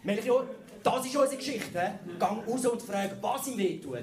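high, loud thrilled chief editor; excited editor. Retold or imitated. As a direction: pounce on the story. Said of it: "der Chefredakteur".

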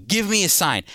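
ordinary talk, nothing marked

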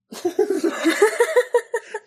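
loud high laugh. Real, not acted. Big laugh at joke.

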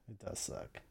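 it does suck whispered, unvoiced. Shown as a demonstration. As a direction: breathe it does suck under the breath. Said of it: "repeated softer".

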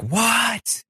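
high-pitched disbelieving "what"; shocked mock reaction. Ally learns Michael is on the block, shocked. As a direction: imitate a stunned ally, voice shooting up. Acts out Monty's shock at nomination.

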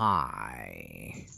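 very low, quiet, drawn-out hello; mock-creepy imitation, sunk right down in pitch; Joker's greeting. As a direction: stretch it out low and soft, imitating a villain. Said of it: "he's like".

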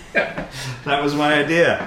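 slow, laughing through it. Real, not acted. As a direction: chuckle the words out slowly. Laughing at own pose.

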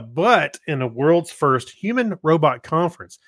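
ordinary delivery

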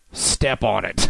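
low mock gangster line; poor gangster voice. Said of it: "trying to do the gangster voice".

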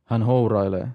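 ordinary delivery, nothing marked

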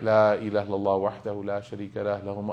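low, soft Arabic remembrance murmured while walking; murmured dhikr. Retold or imitated. Preacher reenacting his tawaf dhikr, low murmured recitation. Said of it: "I'm making my adhkar".

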